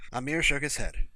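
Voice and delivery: plain reading voice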